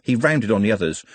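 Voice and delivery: ordinary delivery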